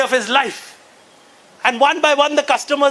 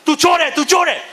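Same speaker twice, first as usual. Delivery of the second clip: slightly raised, punchy crowd jeer; mock angry accusation; 3 semitones higher than usual. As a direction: act out passengers hurling an accusation. Angry crowd accusing airline man.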